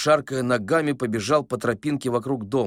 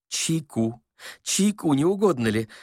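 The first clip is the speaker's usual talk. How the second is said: put-on muttering old man; 5 semitones higher than usual. A narrator's reading. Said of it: "бормотал старик".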